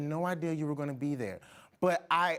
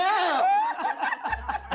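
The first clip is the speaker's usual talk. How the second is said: big high laugh; 6 dB louder than usual. Real, not acted. Host bursting out laughing at her own story.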